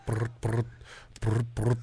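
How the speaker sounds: low, soft trilled ringtone; imitated phone ring. Host starting a pretend call-back, soft trilled phone ring. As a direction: quietly roll out double rings like a telephone.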